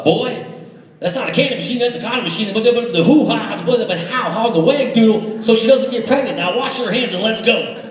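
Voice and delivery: nervous dad. Retold or imitated. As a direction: imitate a freaked-out father fumbling the birds-and-bees talk. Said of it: "he got real nervous".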